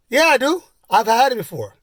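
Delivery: put-on very high, loud kid's protest; insistent kid. Kid insisting to the biker he's had it, insistent. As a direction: imitate a child insisting he knows better.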